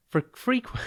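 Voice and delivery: high, tripping over the word; flustered stumble. Real, not acted. Host tripping over the word on the podcast, flustered and high. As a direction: catch yourself mid-word. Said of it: "I'm getting my frequents and my frequents mixed up".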